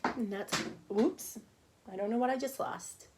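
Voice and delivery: usual speaking voice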